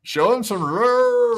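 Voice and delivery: high, drawn-out hype call; hype shoutout. Acted. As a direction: sing out the shoutout. Echoing the shoutout.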